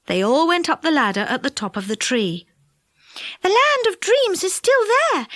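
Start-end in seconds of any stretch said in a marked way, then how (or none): 3.4-5.3 high-pitched little fairy voice; fairy girl, excited. A narrator's reading. Silky peeping over the ladder top, high and eager. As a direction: lift the voice high and report eagerly.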